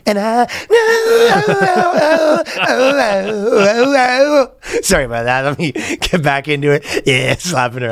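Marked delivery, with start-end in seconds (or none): 0.5-4.8 high sung falsetto wail; mock falsetto wail. Pretending to sing into a voice note, long high wail.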